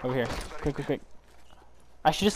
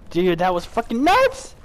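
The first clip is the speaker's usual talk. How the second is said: slow, high, loud hype; excited; 8 semitones higher, 5 dB louder, slower than usual. Real, not acted. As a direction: stretch it out in disbelief. Celebrating the fight.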